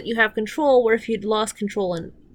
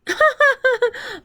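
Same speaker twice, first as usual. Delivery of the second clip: laugh; 5 dB louder than usual. Real, not acted. Laughs at the show.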